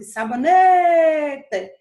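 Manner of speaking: "sabonete" with "ne" called out long and loud; stretched stressed syllable. Shown as a demonstration. Teacher showing where stress falls, "ne" of "sabonete" drawn out loud. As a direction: hold the "ne" in "sabonete" loudly. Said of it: "quando eu passo mais tempo no né".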